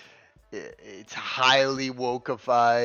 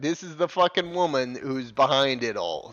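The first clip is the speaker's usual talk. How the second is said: ordinary talk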